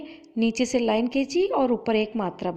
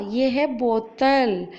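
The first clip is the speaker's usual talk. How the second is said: slow, careful teacher model of बोतल; slow clear model word; much slower than usual. Shown as a demonstration. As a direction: say हाँ, ये है बोतल slowly and clearly for the class. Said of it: "आप भी बोलना मेरी जिस तरह".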